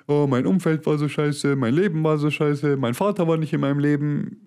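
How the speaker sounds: a touch of whiny mock complaint; mock complaining. Mocking people who blame their upbringing, mildly whiny voice. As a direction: lightly imitate someone moaning about their past. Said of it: "beschweren sich nonstop nur".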